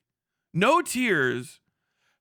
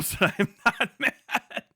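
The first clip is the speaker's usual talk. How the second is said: laughing mockery; 6 semitones higher, much slower than usual. Laughing mock.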